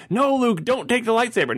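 put-on a young fan begging the hero not to do it; kid pleading with the screen. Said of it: "I remember being a kid going".

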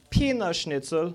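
slow, put-on German pronunciation; mock German accent. Shown as a demonstration. Comic demonstrating German to the crowd, slow mock German accent. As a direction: draw it out in fake German. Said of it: "how I say penis in German".

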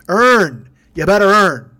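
pimp shouting orders. Pretend. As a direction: yell it like a pimp demanding money. Pimp yelling at his drivers.

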